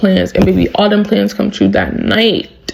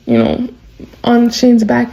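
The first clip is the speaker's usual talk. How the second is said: ordinary talk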